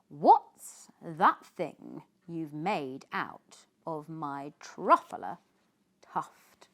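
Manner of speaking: loud, slow, huffing demand; shouted and puffed. A narrator's reading. Lorax yelling at the Once-ler over the tuft, puffing angrily. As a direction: bellow it out, puffing with outrage. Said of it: "he shouted and puffed".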